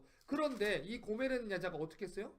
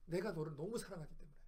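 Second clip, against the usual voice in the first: soft, lower, tender answer; 6 semitones lower, 7 dB quieter than usual. Acted. Preacher voicing God to the congregation, soft and tender. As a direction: say it gently like a confession of love. Preacher voices God's love again.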